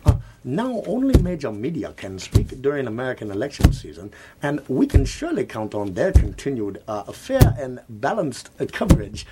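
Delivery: hammed-up fake Chinese accent; a mock Chinese accent. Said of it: "in a fake Chinese accent".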